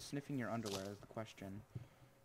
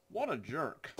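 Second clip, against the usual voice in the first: higher, rising put-on outrage on jerk; mock indignant; 4 semitones higher, slower than usual. Real, not acted. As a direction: ham up the insult.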